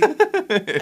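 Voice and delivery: high, loud laugh breaking out; real burst of laughter. Real, not acted. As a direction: crack up laughing at the question. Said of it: "I can't say that without laughing".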